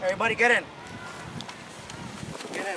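normal talking voice

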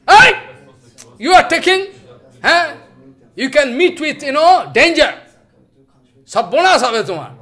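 sudden loud high shout of scolding; angry rebuke. Retold or imitated. Gaur Kishore Baba shouting angrily at the man touching his feet. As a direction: bark at him for grabbing the feet. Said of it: "Gaur Kishore Baba being very angry".

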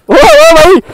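high calling shout after a rider. Real, not acted. Vlogger calls after the man riding off with his bike, a high shout. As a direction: yell to get him to stop.

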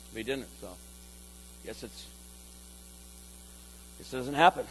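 low, soft, defeated voice of a doubting churchgoer; discouraged believer. Retold or imitated. Preacher voicing a let-down believer, low and soft. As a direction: sigh it out like someone who gave up.

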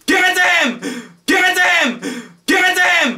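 high-pitched squealed chant; excited cheering. Real, not acted. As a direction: squeal it out, egging them on. Cheering on a screen couple.

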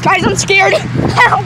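high loud cries for help; panicked yelling. Real, not acted. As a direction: yell for help in terror.

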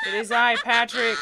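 loud, grand announcement; heroic shout. Pretend. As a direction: proclaim yourself to the boss. Answering the boss heroically.

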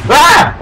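sudden high loud yelp of fright; startled shout. Real, not acted. Player shouts at a jump scare, a high loud yelp. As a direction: let out a sharp scared shout. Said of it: "I think I'm gonna shout".

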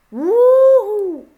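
loud high whoo-hoo; loud high gleeful whoop; excited whoop. Real, not acted. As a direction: cheer the chaos on screen. Wild crash on screen.

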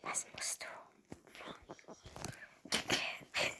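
soft unvoiced whisper. Real, not acted. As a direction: breathe the words quietly. Sneaking past sleeping mother.

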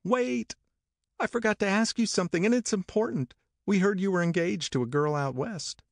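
high, urgent calling out; peremptory call. A narrator's reading. Daisy calling to Nick at his car, high and urgent. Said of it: "Daisy peremptorily called".

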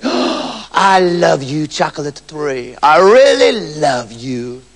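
slow, hushed doting croon to a tree; the king swoons over his chocolate tree, slow and adoring; lovestruck king. Acted. King to his chocolate tree, slow doting croon. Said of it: "stand next to it and say".

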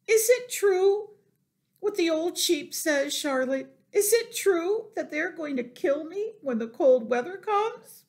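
high, loud frantic questioning; screaming panic. A narrator's reading. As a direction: plead as a piglet in terror. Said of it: "screamed Wilbur".

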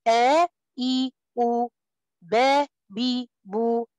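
آ إي أو با بي بو each given full time, slowly; full vowel length. Shown as a demonstration. Teacher drilling vowels for the class, slow and full. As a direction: stretch every vowel its due length. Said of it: "إعطاء الحرف الزمن الصوت بتاعه".

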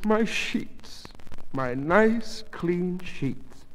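high, halting tearful lament; sobbing. A narrator's reading. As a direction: mourn it through sobs, word by word. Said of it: "she began to sob".

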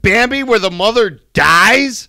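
high, loud and slow, hammed-up outrage; mock rant. Co-host ranting against Bambi, slow loud mock outrage. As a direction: drag it out in fake indignation.

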